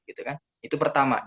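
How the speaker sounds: ordinary talk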